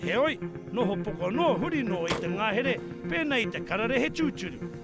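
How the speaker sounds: steady Māori voiceover; documentary narration. A narrator's reading. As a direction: narrate it like a TV presenter.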